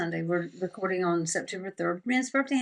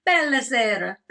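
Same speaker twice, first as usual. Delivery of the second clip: hammed-up Italian phrase, louder; playful fake Italian accent; tossing off Italian for fun; mock Italian; 4 semitones higher, 7 dB louder than usual. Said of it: "So good at accents".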